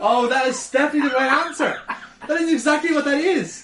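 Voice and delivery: high, loud, through real laughter; laughing agreement. Real, not acted. Co-host delighted by the explanation, agreeing through laughter. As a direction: crack up while agreeing emphatically.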